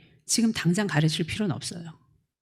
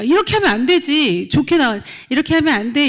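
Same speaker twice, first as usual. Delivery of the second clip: louder, higher stern telling-off; lecturing scold; 5 semitones higher, 6 dB louder than usual. Retold or imitated. Teacher scolding the child who hit her, lecturing. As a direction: wag a finger and scold the child. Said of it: "이렇게 하겠습니까?".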